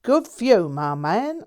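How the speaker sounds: a breezy lad spinning his tale; lightly voiced cheeky Londoner. A narrator's reading. Jack teasing his old friend, in a cheery Londoner voice. Jack's voice.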